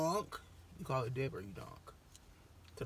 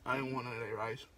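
talking between bites; muffled chewy speech; mouth full chewing; faster than usual. Real, not acted. Seen 2: Lips pursed, cheeks working between words; fried item held near mouth.

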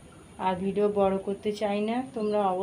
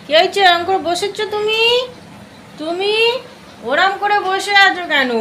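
much higher, loud cooing at Ronny; fond fussing in a high voice; 11 semitones higher, 10 dB louder than usual. Real, not acted. Fussing over pet Ronny.

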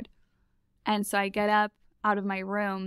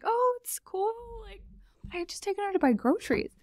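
much higher, forced-casual chirp; fake breezy; 13 semitones higher than usual. Brushing off the roommate who ate her food, fake-breezy and high. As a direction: pretend it's fine while stunned.

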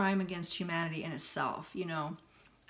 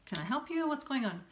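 higher-pitched, obliging question; put-on niceness, pitched up; nice, polite asking; 6 semitones higher than usual. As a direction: ask helpfully in a lifted, polite voice. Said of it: "I had to be nice and ask".

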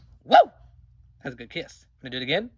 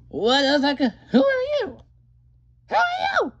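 pitched way up, loud and startled; high squeaky character voice; 12 semitones higher, 8 dB louder than usual. Acted. Startled creature yelling at the intruder, very high. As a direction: shriek it like a shocked little creature.

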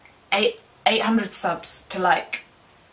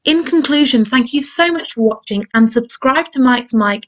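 loud outro; 8 dB louder than usual. Real, not acted. Shouted outro plug.

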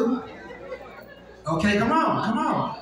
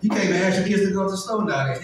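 usual speaking voice

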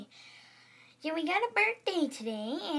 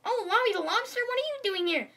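high, louder puppet voice; surprised greeting; 9 semitones higher, 5 dB louder than usual. Acted. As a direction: exclaim at an unexpected visitor. Another puppet greets lobster in surprise.

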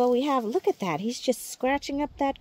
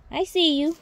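slightly high, lightly cooed to the dog; a hint of doggy voice; lightly playful peekaboo to the dog; a touch of pet talk; 6 semitones higher than usual. Real, not acted. Gardener talking to her dog.

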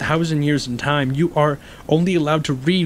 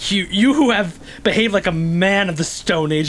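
normal talking voice

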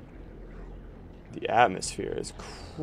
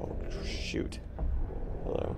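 whispering; 8 dB quieter than usual. Real, not acted. Quiet moment in creepy level.

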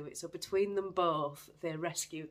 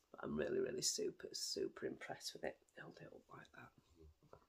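much softer, breathy hushed whisper; whispered aside; 13 semitones lower, 9 dB quieter than usual. Real, not acted. Showing the finished shawl to viewers, a soft whisper. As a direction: drop to a whisper as you lift it.

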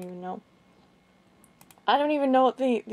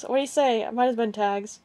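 normal talking voice

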